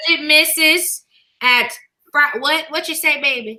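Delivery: ordinary talk, nothing marked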